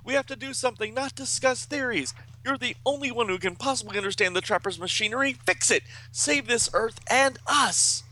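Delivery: urgent, high-pitched comic-book hero; high, insistent exclamations, stretching the final plea; Kaz. A narrator's reading. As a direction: plead and command in a high, strained voice. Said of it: "Kaz is behind him going".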